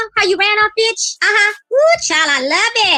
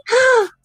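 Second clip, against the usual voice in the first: high-pitched audible gasp; quick dramatic intake of breath; shocked gasp; 5 semitones higher than usual. Acted. Reacting to news of a death.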